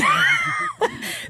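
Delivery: a loud high laugh right after the joke; real laughter that peaks high; shrieking laugh. Real, not acted. Laughs after sarcastic quote.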